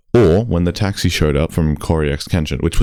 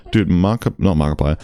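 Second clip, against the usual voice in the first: ordinary talk, nothing marked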